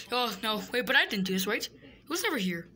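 ordinary talk

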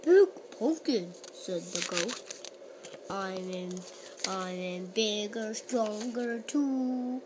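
a hint of a spooky ghost. Ghost speaks in the story.

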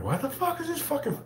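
incredulous high-pitched grumbling; baffled complaint. Retold or imitated. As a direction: voice jumps way up in mock bewilderment. Acts out his baffled reaction to staring.

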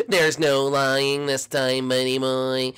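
drawn-out, slow mocking taunt. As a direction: stretch every word to mock him. Taunting rival player.

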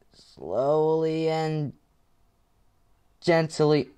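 put-on slow, soft coaxing like soothing a child; sanft flüsternd. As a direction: ease it out gently and slowly. Repeats coaxing line.

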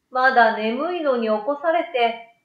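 ordinary delivery, nothing marked

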